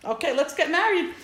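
high-pitched, breezy eagerness, retold; acted-out excitement, pitch jumping up; eager suitor. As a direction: play the keen groom brushing worries away. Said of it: "he's very eager and he's very excited".

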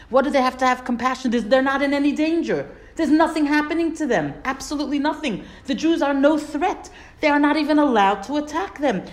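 rising, incredulous outrage; indignant. Real, not acted. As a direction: insist there's no danger at all. Indignant rebuttal of Balak's narrative.

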